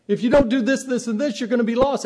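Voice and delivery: loud, high insistent warning; forceful warning. Retold or imitated. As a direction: hammer the warning home. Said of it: "it might have been the truth".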